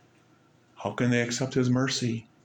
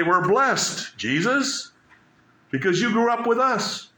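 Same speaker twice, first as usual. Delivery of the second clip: mock expectant crowd; 11 semitones higher than usual. Mocking what Nazareth expected to say.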